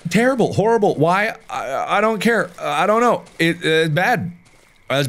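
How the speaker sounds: high, frantic squealing disgust; real panicky shriek. Real, not acted. Gamer shrieking in disgust at a gruesome corpse.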